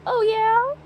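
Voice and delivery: high, rising ad-lib yeah. Retold or imitated. Vlogger mimicking the song's background ad lib, high yeah. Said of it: "that's what the ad lib is".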